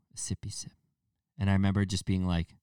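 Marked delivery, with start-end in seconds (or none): 0.1-0.7 put-on soft, breathy cooing baby talk; whispered baby talk. Buddy cooing to his wife with a drink. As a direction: hush it sweetly like offering a toddler a drink. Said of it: "people use baby voices".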